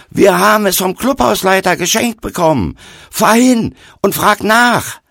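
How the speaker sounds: high, very loud yell; bellowing after. A narrator's reading. Boy repeating the clubhouse explanation to the stranger, bellowing. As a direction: yell it after him forcefully. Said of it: "Wieder brüllte ich hinterher".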